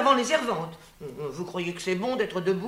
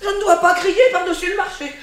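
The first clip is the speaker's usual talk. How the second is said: higher, louder, with a bitter laugh; indignant yell; 9 semitones higher, 6 dB louder than usual. Acted. Nurse shouts back at Antigone for telling her not to shout. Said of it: "tu ne devrais pas trop crier".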